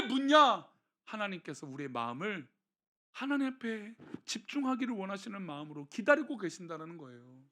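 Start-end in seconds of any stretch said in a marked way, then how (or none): none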